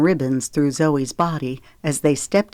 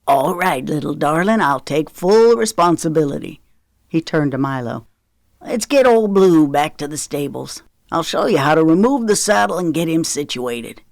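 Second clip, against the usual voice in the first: lightly voiced genial Southern father; a hint of a playful dad; Knox character voice. A narrator's reading. Knox reassuring his daughter then grandson, lightly voiced father.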